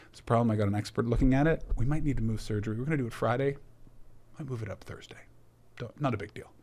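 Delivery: low, soft, level delivery; emotionless surgeon. Retold or imitated. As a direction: imitate a doctor with no emotion. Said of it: "possibly the most emotionless man".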